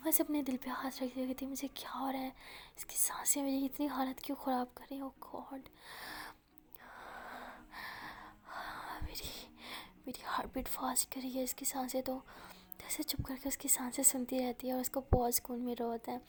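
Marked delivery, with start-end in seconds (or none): 1.5-12.0 soft, flustered whisper; girl listening to breathing on the phone, flustered whisper; breathless whisper. Acted. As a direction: whisper as if her heart is racing.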